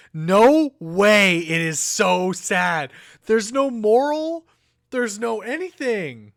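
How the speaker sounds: loud, high disbelief at the page; stunned, loud and high; shocked outburst. Real, not acted. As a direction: cry out in shocked outrage. Shocked reaction to sad book.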